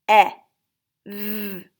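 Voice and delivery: H-E-V-E as four separate sounds, long gaps; tapped sound by sound. Shown as a demonstration. Teacher tapping out heavy, H-E-V-E sounded in pieces. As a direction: sound out H-E-V-E one sound at a time. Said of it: "Let's tap it".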